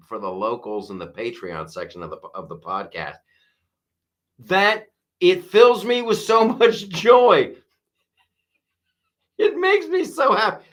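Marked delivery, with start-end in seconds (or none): 4.4-10.6 high, laughing delight; laughing with joy. Real, not acted. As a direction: giggle through the joy. Joy at chat insults.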